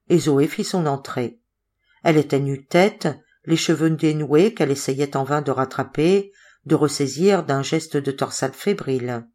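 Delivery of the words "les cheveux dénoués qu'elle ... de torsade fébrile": usual speaking voice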